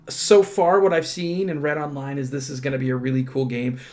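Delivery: ordinary talk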